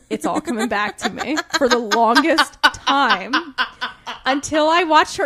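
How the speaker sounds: talking through laughter; laughing. Real, not acted. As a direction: laugh while telling it. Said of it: "I thought that it was [name] singing".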